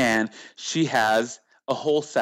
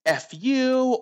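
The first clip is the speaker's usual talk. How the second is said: mock insult; 12 semitones higher than usual. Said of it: "they always start harassing me".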